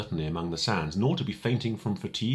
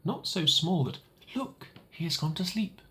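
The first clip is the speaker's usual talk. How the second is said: little prince voice; 10 semitones higher than usual. Acted. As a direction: hush so the sheep can sleep. Little prince looks at drawing.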